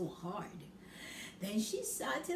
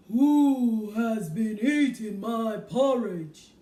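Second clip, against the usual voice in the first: big booming slow bear voice, much louder; very loud Father Bear; 12 dB louder, slower than usual. Acted. Father Bear asks about porridge, booming loud slow. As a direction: boom it out slow like a huge bear. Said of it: "said in a very loud voice".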